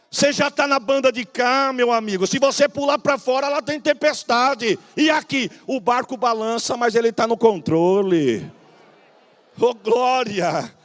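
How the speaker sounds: loud, fervent preaching to the congregation; full-force preaching. Acted. Pastor warns the congregation at full force, loud preaching. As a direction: preach it loud, as a warning from the pulpit.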